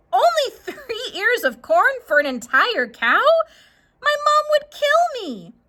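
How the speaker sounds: high, loud disbelief with a laugh; incredulous child. Acted. Jackie balking at the stranger's offer, high and incredulous. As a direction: scoff at the tiny offer.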